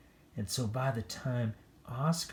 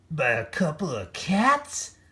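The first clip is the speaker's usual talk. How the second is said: high disbelieving whine; moaning dogs; 6 semitones higher, 6 dB louder than usual. A narrator's reading. Embarrassed dogs moaning, lightly high. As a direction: whine it in shame. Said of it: "they moaned".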